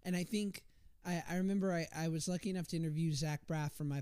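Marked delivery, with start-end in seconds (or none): none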